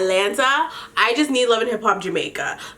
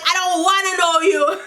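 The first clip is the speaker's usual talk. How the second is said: higher put-on voice for the retort; 8 semitones higher than usual. Imitating [name] snapping back at [name], higher and mocking. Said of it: "So [name] is like".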